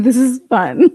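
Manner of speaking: loud hammed-up imitation; Karen Reed. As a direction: mockingly mimic her, breaking into laughter. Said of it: "That's what Karen Reed was like".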